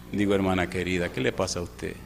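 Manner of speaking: low, soft pastoral aside; gentle reproach. Retold or imitated. Pastor asks the upset wife what is wrong, gentle reproach. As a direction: drop low and soft to calm her.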